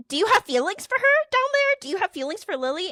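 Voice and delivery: high-pitched pressing questions; acted-out disbelieving grilling; incredulous interrogation. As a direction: retell demanding an answer, pitch jumping up. Retold interrogation of cheating friend.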